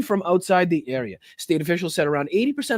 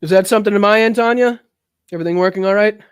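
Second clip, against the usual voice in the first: louder, raised call to someone off mic; 3 semitones higher, 7 dB louder, faster than usual. Real, not acted. Streamer checking a technical problem with a helper, calling out.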